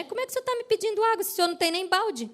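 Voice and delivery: mock-incredulous, hurried question; woman's retort. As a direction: imitate a puzzled woman questioning him rapidly. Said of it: "ela deu essa resposta pra ele".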